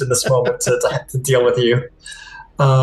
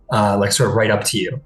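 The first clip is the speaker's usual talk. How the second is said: ordinary delivery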